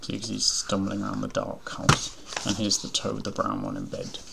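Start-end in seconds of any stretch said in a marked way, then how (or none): none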